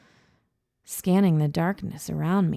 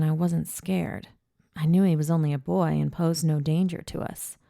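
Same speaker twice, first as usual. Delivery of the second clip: ordinary delivery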